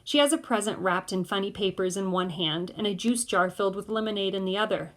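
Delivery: ordinary delivery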